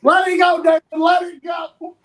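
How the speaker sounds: loud, high shout with a drawn-out finish; urging shout. Real, not acted. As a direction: yell it at a friend to make them drop it. Urging co-host to let it go.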